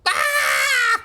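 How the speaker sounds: sudden high yelp of fright; startled yelp. Real, not acted. Gamer jolted by the game, sharp startled yelp. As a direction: jump and let out a sharp cry.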